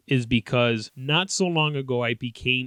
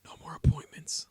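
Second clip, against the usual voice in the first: hushed, voiceless plea; imitated whisper; 7 dB quieter than usual. Daughter lifting her head to dad, imitated whisper. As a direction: whisper it like a fed-up child. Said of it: "She goes".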